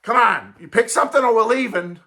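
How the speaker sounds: hammed-up mom threatening to leave; impatient mom. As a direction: lay down the ultimatum like a fed-up mother. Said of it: "Mom's going".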